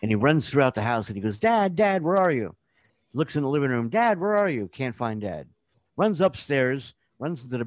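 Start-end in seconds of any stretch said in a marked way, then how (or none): 1.4-2.6 put-on higher-pitched kid calling for dad; mock searching call. Child searching house calls out. 3.9-4.7 mock kid calling for dad; lightly acted searching call. Child still searching for dad.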